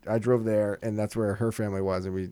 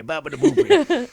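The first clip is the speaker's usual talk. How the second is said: mock Italian; 11 semitones higher, 8 dB louder than usual.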